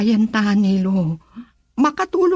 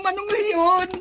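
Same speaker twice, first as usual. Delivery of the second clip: crying; 6 semitones higher, slower than usual. Acted. Frightened cry out.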